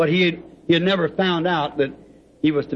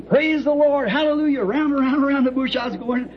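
a mock of shouting praise while circling; excited higher praise; retold joyful shout; 8 semitones higher than usual. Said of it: "I was screaming".

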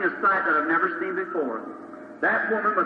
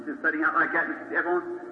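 ordinary talk

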